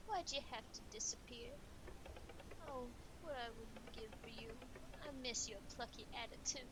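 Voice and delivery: lightly theatrical lament; acted wistful mourning; grieving melodramatic admirer. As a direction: mourn a lost idol with florid longing. Plays mourner lamenting King in a game.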